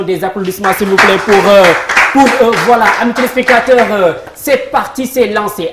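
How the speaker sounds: plus fort et plus aigu, élan d'animateur télé; annonce enthousiaste. Real, not acted. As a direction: lance le jeu avec énergie. Host calls for applause, launches. (l'animateur aux téléspectateurs, lancement très enthousiaste)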